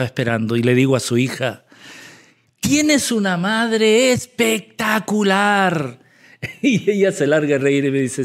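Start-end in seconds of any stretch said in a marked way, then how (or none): 2.6-6.0 slow, drawn-out acted exclamation; effusive praise. Driver praises passenger to her daughter, effusively. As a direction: stretch the compliment out with relish.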